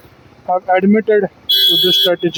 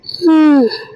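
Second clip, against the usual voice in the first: heavy sigh; 9 semitones higher than usual. Real, not acted. Sigh before apologising.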